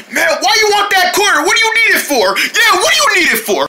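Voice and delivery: high-pitched, pestering demand; squabbling, high-voiced challenge; indignant questioning. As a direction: press for an answer in a high protest. Quarrel over the quarter.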